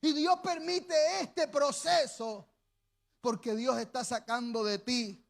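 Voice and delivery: ordinary talk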